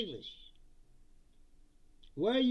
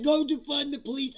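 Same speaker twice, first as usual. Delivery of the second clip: plain; 7 semitones higher, 7 dB louder than usual. Retold or imitated. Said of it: "he's like".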